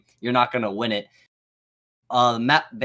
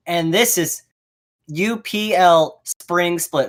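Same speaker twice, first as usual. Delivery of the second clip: slow, higher robotic drone; 6 semitones higher, 4 dB louder, slower than usual. Retold or imitated. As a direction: imitate a robot announcer, slowly. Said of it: "you were like".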